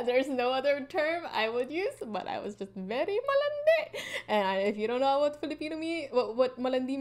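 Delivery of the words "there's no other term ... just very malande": laughing. Real, not acted. Laughing confession of being flirty.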